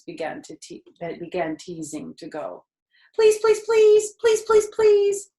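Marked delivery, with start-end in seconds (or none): 3.1-5.3 high squeaky pleading gosling; begging gosling. A narrator's reading. Gosling begging its mother, high and squeaky. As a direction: beg like a tiny gosling, up high. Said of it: "They made quite a racket".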